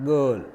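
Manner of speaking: put-on girl with a lengthened er; drawn-out er in "girl"; long vowel in girl. Shown as a demonstration. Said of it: "So, word is pronounced".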